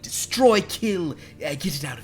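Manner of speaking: high, loud fussy fae; a high fae pleading with the party; Galliard. Acted. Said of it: "He's gonna say".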